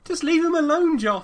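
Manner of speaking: loud, high scolding; loud telling off. Real, not acted. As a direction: call him off loudly. Telling cohost to stop.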